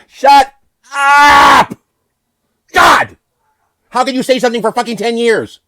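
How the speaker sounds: very high, very loud exploding yell; furious scream. Real, not acted. Speaker sick of ten years of repetition, furious scream. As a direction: scream it at the end of your patience.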